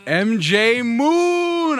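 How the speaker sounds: high, slow drawn-out name announcement; hype announcer. Pretend. Host unveiling the guest's new nickname, hype announcer. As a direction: stretch the nickname out like a ring announcer.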